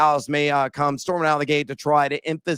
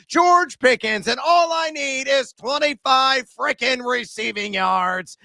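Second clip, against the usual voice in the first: excited emphasis; 6 semitones higher, slower than usual. Acted. Hyping the last pick.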